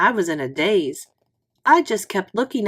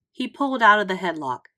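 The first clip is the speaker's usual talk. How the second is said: ordinary delivery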